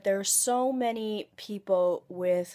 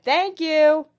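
imitating homeless guy; 10 semitones higher, 11 dB louder than usual. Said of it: "and he went".

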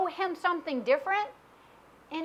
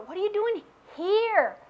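higher question, long falling emphasis on "here"; stress on HERE; 6 semitones higher than usual. Shown as a demonstration. Preacher trying a third reading of God's question, "here" stressed. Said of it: "we put our own intonations in".

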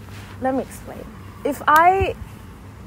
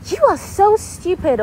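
higher, louder, drawn-out put-down; scornful mockery; 11 semitones higher, 5 dB louder, slower than usual. Kids mocking someone who asked a question, scornful. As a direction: stretch it out like a scornful kid.

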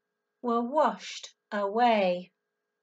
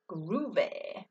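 cool cat; 8 semitones lower, 9 dB quieter than usual. Acted. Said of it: "this time he says".

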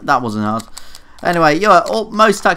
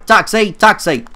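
calling out; 6 semitones higher, 5 dB louder than usual. Acted. Hailing a taxi in game.

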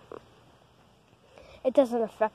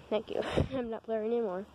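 normal talking voice

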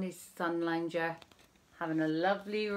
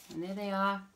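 usual speaking voice

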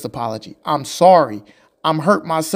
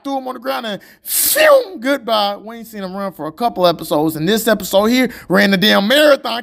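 normal talking voice

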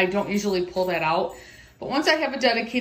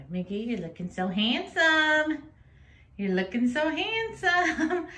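praising the dog; 8 semitones higher, slower than usual. Real, not acted.

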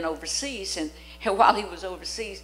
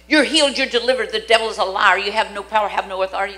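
loud, forceful preacher's proclamation; commanding declaration; 8 dB louder, faster than usual. Acted. Preacher proclaiming healing to a sick person, loud and forceful. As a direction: declare it over them with full authority.

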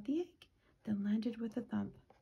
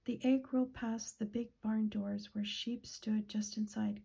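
usual speaking voice

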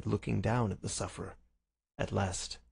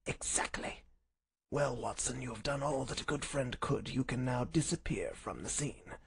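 soft, faint sickbed voice; feeble invalid Holmes; 3 semitones higher, 6 dB quieter than usual. Acted. Sick Holmes sends his friend away, feeble voice. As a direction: dismiss him weakly but crisply. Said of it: "he spoke in a feeble voice".

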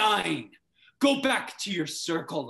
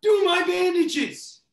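urgent shout; 13 semitones higher, 6 dB louder than usual. Acted. Son calls out urgently.

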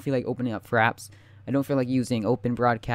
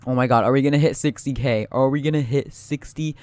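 ordinary talk

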